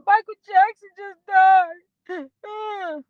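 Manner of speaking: high, drawn-out wail, re-enacted; mock crying. Bursting in to tell coworkers, acted crying. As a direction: wail it slowly, acting out your own tearful outburst. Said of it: "bust through the door crying".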